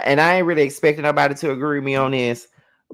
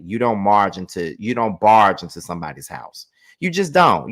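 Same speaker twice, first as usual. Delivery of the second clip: usual speaking voice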